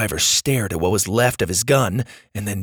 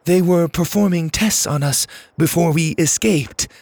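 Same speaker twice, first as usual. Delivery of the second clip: higher-pitched alien plea; clipped, desperate; 6 semitones higher than usual. A narrator's reading. Lainey tells the driver of tests, clipped and desperate. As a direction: plead in strangely clipped words. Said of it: "Her tone was strangely clipped and her voice sounded genuinely desperate".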